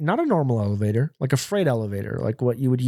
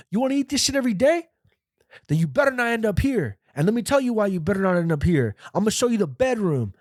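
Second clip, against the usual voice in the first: high, strained inmate lecture; mock shouted warning, volume held back; imitated yelling; 8 semitones higher than usual. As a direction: mimic a hectoring inmate in raised pitch. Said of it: "Why is he yelling at us?".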